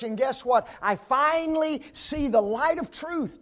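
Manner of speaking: loud, high-pitched revival preaching; preaching at full force. Acted. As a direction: lift the room with it. Preaching climax.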